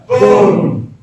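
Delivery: big high shout of boom. Shown as a demonstration. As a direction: bellow it out to lead the room. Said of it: "shout boom as loud as you can".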